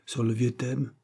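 ordinary delivery

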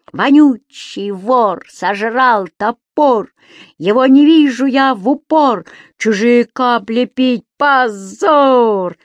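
громкая дразнилка, выше и громче; чеканить речёвку, как на марше; орёт речёвку. A narrator's reading. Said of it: "орал Субастик". (Субастик орёт дразнилку в такт шагам, громко и выше)

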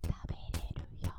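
soft unvoiced whisper. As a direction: breathe the words, no voice. Whispered shadowing of phrase.

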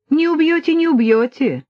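smiling confidence. A narrator's reading. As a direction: wave the threat away with a smile. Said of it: "Кажется, молодой улыбнулся". (с улыбкой, уверенно, высоким голосом)

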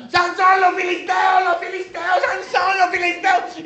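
high, frantic repeated warning cry; full-volume panic call, mock urgency; shouted alarm. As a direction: yell it over and over at the top of your voice. Dalila raises the alarm again.

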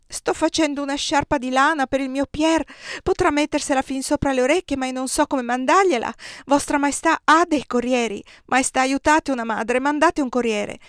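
high, loud begging; desperate pleading. A narrator's reading. Maria begging the emperor for her son, desperate. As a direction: plead on your knees for your son.

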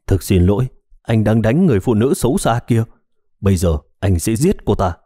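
ordinary delivery, nothing marked

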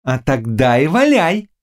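high, slow witch's jeer; gleeful witch. Acted. Witch goading the Cossack before she cackles, high and drawn out. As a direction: draw it out with wicked relish. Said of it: "сказала ведьма и залилась громким хохотом".

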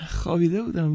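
nervous high whine; flustered moan. A narrator's reading. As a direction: whimper it out, flustered. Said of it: "با صدایی شبیه به ناله گفت".

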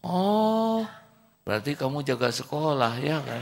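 high, bright-toned teacher reply. Acted. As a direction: answer him in a high voice. Teacher answers boy. (suara guru tinggi)

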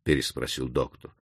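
plain reading voice

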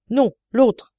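loud, high frantic voice; agitated prisoner. A narrator's reading. Prisoner rejects the new judge, loud frantic voice. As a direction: insist wildly, other voice.

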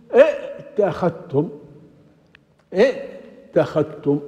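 ائتخذتم said slowly with the glottal stop before the t clearly voiced; underlying form spelled out. Shown as a demonstration. Teacher slowly sounding out the underlying form ائتخذتم. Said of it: "أصل".

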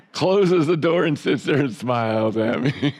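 high laughter through words; laughing. Real, not acted. Preacher laughing about wife's car-door game, laughing.

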